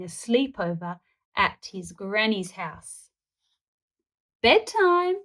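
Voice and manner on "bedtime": high, bright call of bedtime; announced bedtime. A narrator's reading. Granny announcing bedtime to her grandson. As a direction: sing it out to call time. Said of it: "announced Granny".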